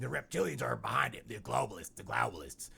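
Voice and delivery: loud, ranting conspiracy host; put-on voice, railing at hidden enemies; Alex Jones. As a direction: rant it like a conspiracy broadcaster. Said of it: "You know how Alex Jones feels".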